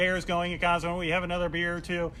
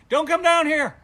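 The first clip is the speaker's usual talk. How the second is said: loud, high, slow yell; panicked shout; 11 semitones higher, 11 dB louder than usual. Retold or imitated. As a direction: stop her coming down, frantic. Yelling up stairs again.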